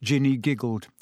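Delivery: plain reading voice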